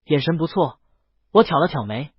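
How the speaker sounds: plain reading voice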